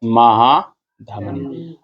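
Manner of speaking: "महाधमनी" को तोड़कर बहुत धीरे; "महा" पर रुकें, फिर नीचे सुर में "धमनी"; शब्द दोहरवाने का लहजा. Shown as a demonstration. कक्षा के बाद शब्द दोहराना. (कक्षा के लिए "महाधमनी" के दो टुकड़े)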